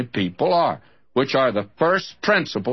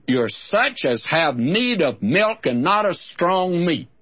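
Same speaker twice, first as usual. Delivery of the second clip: higher, measured Bible reading; scripture reading; 4 semitones higher than usual. Acted. Preacher reading Hebrews to his listeners. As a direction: read the verse slowly and solemnly.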